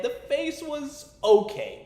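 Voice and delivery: ordinary delivery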